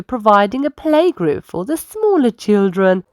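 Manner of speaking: plain reading voice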